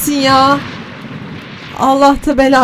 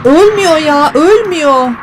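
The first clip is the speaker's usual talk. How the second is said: frustrated outburst; 5 semitones higher, 4 dB louder than usual. Real, not acted. Creature won't die, frustrated.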